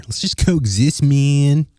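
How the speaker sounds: light, easygoing California surfer accent on coexist; brief replay of the mellow surfer dude voice. Shown as a demonstration. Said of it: "a little surfer accent".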